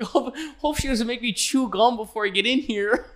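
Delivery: high nervous nerd voice; scared nerd. Retold or imitated. Nerd dreading the gum order, high worried voice. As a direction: fret like a bullied writer. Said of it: "some guy's like".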